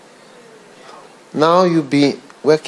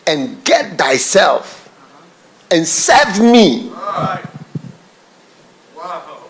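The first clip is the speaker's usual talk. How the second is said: raised, slow, drawn-out scripture reading; fervent scripture reading; 5 semitones higher, 3 dB louder than usual. Real, not acted. Preacher reading Luke aloud, fervent and drawn out. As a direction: declaim the master's command.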